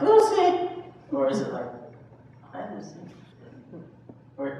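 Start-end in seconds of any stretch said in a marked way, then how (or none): 0.0-0.7 a sharp, much higher and louder cry of "Lucy"; alarmed noting. Shown as a demonstration. Teacher shows a fearful way of noting the inner critic, alarmed cry. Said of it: "you can hear the relationship in the tone of voice". 2.5-3.0 a soft, gentle "Hi, Lucy", much softer; friendly noting. Shown as a demonstration. Teacher shows a kindly way of noting the inner critic, soft greeting. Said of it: "you can hear the relationship in the tone of voice".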